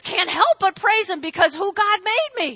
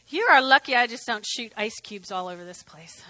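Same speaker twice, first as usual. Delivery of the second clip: normal talking voice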